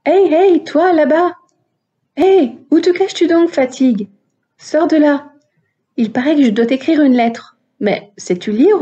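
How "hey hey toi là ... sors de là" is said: higher-pitched hail to someone hiding; calling out. Acted. Woman hailing her own fatigue as it hides, calling out. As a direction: call out to coax someone from hiding.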